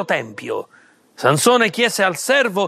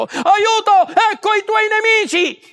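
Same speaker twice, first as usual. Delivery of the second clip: high, urgent pretend call for help; fake alarm cry; 12 semitones higher than usual. A narrator's reading. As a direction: cry out in mock panic, much higher. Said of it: "finse che arrivassero i filistei".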